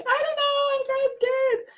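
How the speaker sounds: tiny high squeak of a timid person; hammed-up scared little voice; shy and scared. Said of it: "to shy away and be like".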